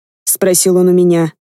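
ordinary delivery

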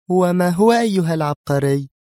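high-pitched, edgy question; irritated. A narrator's reading. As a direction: ask it with nervy sarcasm. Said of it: "قالت في عصبية". (بعصبية)

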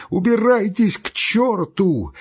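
high, drawn-out wailing cry; tearful shout. A narrator's reading. Doctor shouting after his guests, tearful cry. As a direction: cry out as if near tears. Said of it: "крикнул он плачущим голосом".

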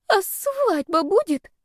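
put-on high, slow little-girl voice; timid girl. A narrator's reading. Girl asks fiancée about the wedding, timid and high. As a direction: ask it timidly, pitched high and drawn out.

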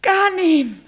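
loud, high call across the room. Acted. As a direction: call his name out longingly. Calls out for her lover. (lauter Ruf nach dem Geliebten)

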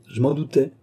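plain reading voice

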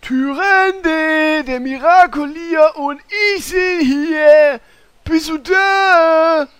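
loud calling out through the temple; shouting. Acted. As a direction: yell to find someone far away. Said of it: "Den Hörsturz hätte ich kommen sehen müssen".

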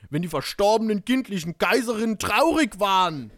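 emphatic, theatrical storytelling; dramatic narration. A narrator's reading. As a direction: declaim the story with big stresses.

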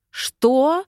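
high, loud incredulous outburst; enraged question shooting sharply upward. A narrator's reading. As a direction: flare up in furious disbelief. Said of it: "взбесилась я".

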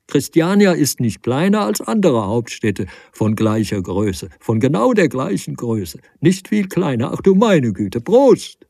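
loud, high parody of the adjunct; mocking parody. A narrator's reading. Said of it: "lachte endlos über ihn".

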